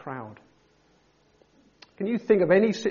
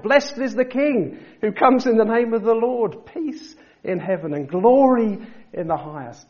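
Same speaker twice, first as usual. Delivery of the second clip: joyful shout, much higher and louder; 7 semitones higher, 8 dB louder than usual. Retold or imitated. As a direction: proclaim it at the top of your voice. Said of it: "They were shouting out".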